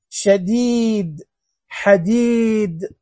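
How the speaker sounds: "شديد" with the ee stretched very long; long ee vowel. Shown as a demonstration. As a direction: draw out "حديد" as hadeeeed, slowly. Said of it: "بالياء". ("شديد" بمدّ الياء طويلاً)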